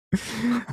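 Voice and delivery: short high laugh; brief amused chuckle. Real, not acted. Laughs after tentacle porn joke.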